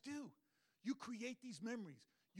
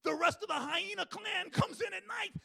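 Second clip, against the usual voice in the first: normal talking voice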